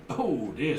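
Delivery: ordinary talk, nothing marked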